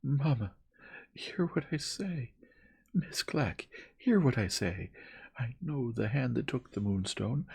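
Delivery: high, urgent demand to be heard; enraged insistence. A narrator's reading. Daughter demanding mother and companion listen, enraged. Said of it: "the rage that possessed her".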